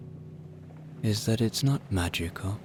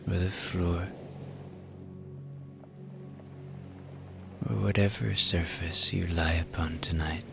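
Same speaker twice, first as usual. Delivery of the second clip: guided relaxation; 8 dB quieter than usual. Acted. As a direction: ease them toward sleep, unhurried.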